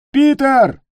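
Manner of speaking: high, loud yell; yelled warning. A narrator's reading. Гек warns Питер of danger, yelling. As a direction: yell his name in alarm. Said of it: "заорал Гек".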